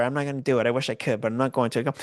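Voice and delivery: ordinary talk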